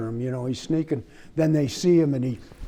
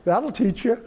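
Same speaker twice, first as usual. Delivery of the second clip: laughing; 14 semitones higher than usual. Retold or imitated. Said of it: "I says".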